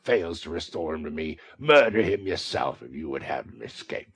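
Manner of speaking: loud menacing threats; exasperated threat. A narrator's reading. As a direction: threaten her with venom. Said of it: "exasperated beyond all bounds".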